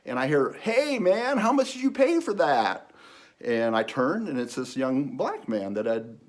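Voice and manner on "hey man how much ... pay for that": high, loud imitated holler; calling out. Young man calling from his car to stranger, calling out. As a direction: call across to someone walking away.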